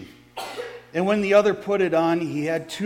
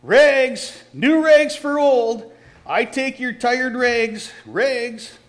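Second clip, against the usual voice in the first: loud, high ragman's cry, drawn out slow; street call; 6 semitones higher, 5 dB louder, slower than usual. Acted. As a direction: sing out your wares down the alley. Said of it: "calling in a clear tenor voice".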